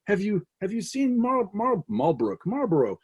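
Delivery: anxious wife voice. Retold or imitated. Wife asks messenger in song.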